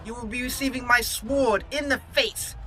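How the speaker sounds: gloating battle taunt. Pretend. Player answering an opponent who needs help, loud gloating taunt. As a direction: taunt the foe with rising glee.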